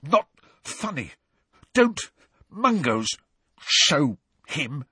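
choked, strangled words; spluttering. Acted. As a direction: splutter through a held throat. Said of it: "odd words spluttered from his mouth".

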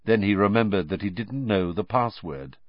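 ordinary delivery, nothing marked

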